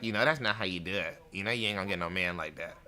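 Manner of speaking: low, soft mock-elder advice; understated, offhand imitation of grandmotherly warning; elder woman's aside. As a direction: drop low and quiet, imitating an old woman. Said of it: "she'll throw it out there".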